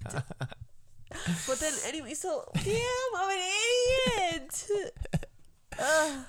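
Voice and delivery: high, breathless giggly voice; laughing at herself. Real, not acted. Wife laughing at her own blunder, high and giggly. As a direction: scold yourself while still giggling.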